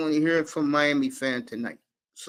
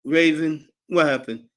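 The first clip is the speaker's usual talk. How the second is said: ordinary talk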